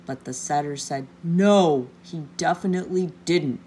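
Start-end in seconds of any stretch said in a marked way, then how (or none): 1.2-3.6 booming NO, then firm; mildly menacing storybook refusal; terrifying tone. A narrator's reading. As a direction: refuse crossly with a loud first word. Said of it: "in a terrifying tone of voice".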